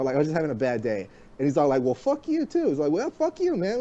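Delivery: ordinary delivery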